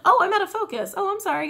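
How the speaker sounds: ordinary talk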